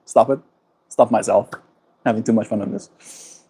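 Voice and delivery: ordinary talk, nothing marked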